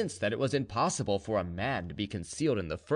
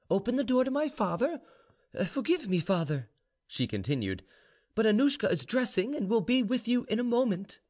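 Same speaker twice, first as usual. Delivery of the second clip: put-on high-pitched young woman, calm; high, unruffled girl's voice; composed daughter; 11 semitones higher than usual. A narrator's reading. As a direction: speak high and politely to your father. Vaninka calls out to her father.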